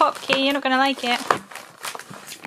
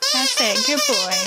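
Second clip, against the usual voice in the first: high dog praise; 14 semitones higher than usual. Real, not acted. Praising the husky for gentle play, high and laughing. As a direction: praise him like a puppy.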